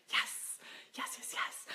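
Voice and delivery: whispered yes. Real, not acted. Whispered agreement while reading comments.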